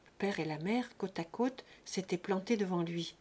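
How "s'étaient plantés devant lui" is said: usual speaking voice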